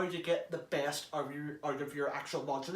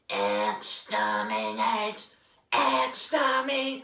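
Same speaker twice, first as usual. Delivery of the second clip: slow, high, loud Dalek cry; Dalek exterminate; 5 semitones higher, 6 dB louder, much slower than usual. Shown as a demonstration. Demoing how to build into the Dalek voice, slow high Dalek cry. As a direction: stretch it out like a Dalek. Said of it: "start off low and just keep going".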